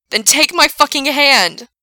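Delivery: slow, high furious shout; desperate shout. A narrator's reading. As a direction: bellow the command. Desperate rescue demand.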